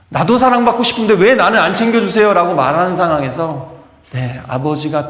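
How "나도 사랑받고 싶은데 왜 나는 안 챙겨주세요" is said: high, loud acted plea; aggrieved complaint. Son complaining to his father about being neglected, acted aggrieved complaint. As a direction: protest as the overlooked son. Voicing unloved son's complaint.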